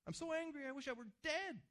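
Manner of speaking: very high, loud, whiny mock anger; Jonah's angry reply. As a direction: play Jonah sulking, shrill with rage, wishing he were dead. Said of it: "He says".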